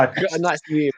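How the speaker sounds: mock Scottish lilt on nice wee; Scottish accent. Friend reading a message aloud in a light mock Scottish accent. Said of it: "reading that in a Scottish accent".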